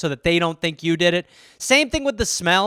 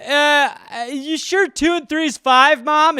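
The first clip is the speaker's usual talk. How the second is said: put-on higher, slow dopey kid voice; dim kid voice; 8 semitones higher, 4 dB louder, slower than usual. Dopey kid asks his mom about sums. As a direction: play the slow child asking Mom. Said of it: "I'd just be like".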